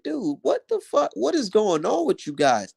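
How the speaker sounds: mock disbelief. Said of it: "I'd be like".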